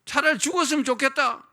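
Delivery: high, loud despairing cry; mock wail. Preacher mimicking the Israelites wishing for death, loud mock wail. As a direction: wail it like a hopeless grumbler. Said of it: "죽지 않았는데도".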